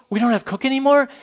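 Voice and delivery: high, loud mock-amazed husband; loud overjoyed disbelief, acted; excited husband. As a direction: join in with hammed-up elation. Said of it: "she gets all excited".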